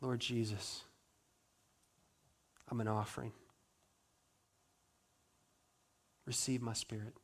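soft, low, hushed surrender; quiet dying prayer. Acted. Dying Stephen gives his spirit to Jesus, hushed and soft. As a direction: pray it barely above a breath.

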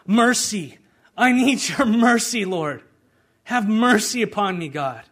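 loud, high plea to God; crying out for mercy. Acted. Preacher crying out to God for mercy, loud and pleading. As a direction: shout it up to heaven, begging.

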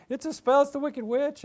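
high, loud, fretful voice; panicky scarecrow. Retold or imitated. Scarecrow repeating the witch warning, high and alarmed. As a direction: squeak out the warning.

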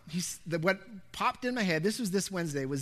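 ordinary talk, nothing marked

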